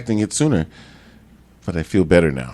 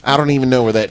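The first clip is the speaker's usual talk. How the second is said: normal talking voice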